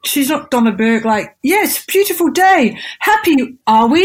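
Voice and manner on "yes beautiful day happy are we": high, sing-out mock cheer; over-cheerful talk as if to small children; mental kindergarten teacher. Mocking her own MC style, kindergarten-teacher chirp. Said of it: "kindergarten teacher with some sort of".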